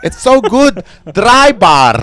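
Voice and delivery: put-on shouted, high-pitched caricature Arabic accent; thick Arabic accent. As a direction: rave about it loudly in a thick Arabic accent. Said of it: "with a thick Arabic accent".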